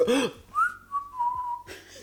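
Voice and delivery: put-on sharp high gasp of a kid caught out; startled gasp. Child caught by mother gasps in a startled fright.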